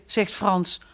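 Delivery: plain reading voice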